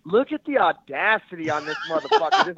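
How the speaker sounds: disbelieving the nerve of it; disbelief. Retold or imitated. Friend shows up uninvited, incredulous disbelief. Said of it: "I was like".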